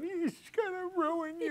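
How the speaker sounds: high, drawn-out, soft wail; slow, hammed-up tearful keening; mock crying mother. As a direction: imitate a mother lamenting in tears. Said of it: "My mother was crying".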